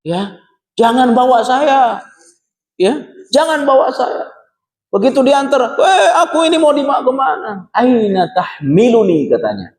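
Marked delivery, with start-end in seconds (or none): 0.8-2.0 high, slow pleading call; retold cry. Sinner's corpse begs its bearers not to carry it, imitated cry. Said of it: "Apa teriakannya?". 3.3-4.3 high-pitched mock protest; retold cry. Sinner's corpse pleads with its bearers, imitated cry. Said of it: "Apa teriakannya?". 5.8-9.3 high, stretched-out wail of protest; retold cry. Sinner's corpse asks bearers where it is being taken, imitated cry. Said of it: "Itu teriakannya".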